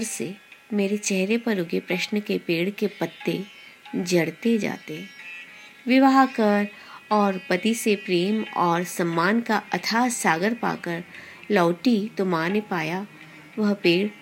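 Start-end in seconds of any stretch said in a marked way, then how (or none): none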